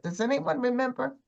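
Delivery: usual speaking voice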